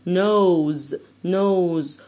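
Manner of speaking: nose, final s voiced as z; nose with a z sound. Shown as a demonstration. Teacher modelling nose for kids, s said as z. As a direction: say nose ending on a buzzing z. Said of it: "هنا هنطقه ز".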